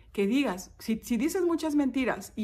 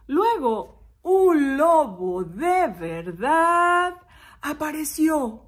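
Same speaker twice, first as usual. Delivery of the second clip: slow, loud, drawn-out announcement; suspenseful reveal; 4 semitones higher, 7 dB louder, much slower than usual. A narrator's reading. Storyteller revealing that a real wolf appeared, slow suspense. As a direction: stretch each word for drama.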